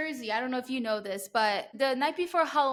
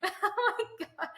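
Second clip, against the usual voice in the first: laughing; 7 semitones higher, much slower than usual. Real, not acted. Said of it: "laughing at own story".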